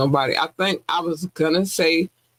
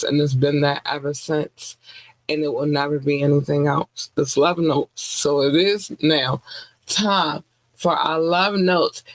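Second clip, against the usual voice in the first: usual speaking voice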